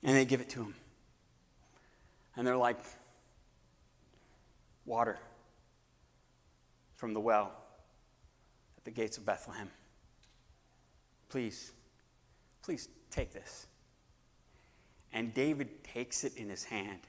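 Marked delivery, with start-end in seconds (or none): 4.8-13.7 reverent hush. Acted. Offering water to David reverently.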